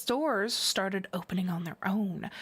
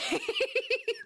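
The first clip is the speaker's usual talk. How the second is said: a squealing laugh, much higher. Real, not acted. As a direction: burst out in a high squeal of laughter. Laughing at honey cakes.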